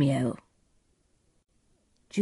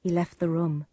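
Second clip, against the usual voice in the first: usual speaking voice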